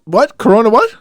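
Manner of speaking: high, loud mock bafflement; mock surprise. Host playing dumb about corona, loud mock surprise. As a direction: act shocked at the word.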